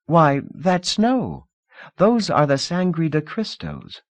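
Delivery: put-on breezy rich girl offering comfort; Lida, young hostess.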